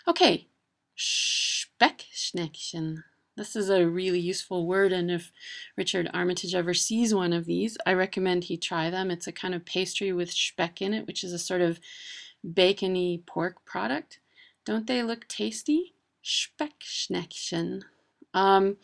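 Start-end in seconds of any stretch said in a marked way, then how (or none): 1.0-3.0 "Speckschneckchen" very slowly, piece by piece; careful German pronunciation. Shown as a demonstration. Showing a really useful word, slow careful "Speckschneckchen". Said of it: "it's really hard to say". 16.2-17.8 "Speckschneckchen" slowly, in separate parts; softer, slow "Speckschneckchen" with "shp" at the start; careful German pronunciation. Shown as a demonstration. Naming a tasty pastry, slow careful "Speckschneckchen". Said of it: "it's really hard to say".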